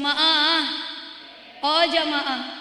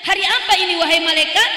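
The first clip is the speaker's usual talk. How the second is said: loud call; 2 semitones higher, 7 dB louder, faster than usual. Acted. Prophet wakes, asks angel.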